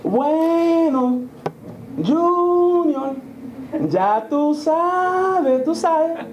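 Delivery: high, slow sing-song Puerto Rican Spanish; Puerto Rican mom. Retold or imitated. Imitating his Puerto Rican mom's sing-song talk. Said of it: "we sing when we talk".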